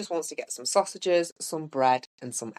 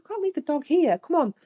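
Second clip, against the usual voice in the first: much higher, wheedling daughter voice; coaxing plea; 12 semitones higher than usual. Retold or imitated. To her dad about the dog in his car, high coaxing plea. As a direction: coax a reluctant dad, sing-song and high.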